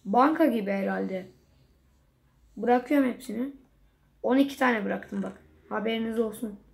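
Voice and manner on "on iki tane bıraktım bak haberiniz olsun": ordinary delivery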